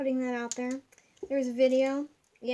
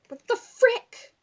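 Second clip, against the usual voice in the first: loud, slow, higher exasperated cry; mock exasperation; 9 semitones higher, 8 dB louder, slower than usual. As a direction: drag it out, fed up with the badge. Frustrated at ruined badge.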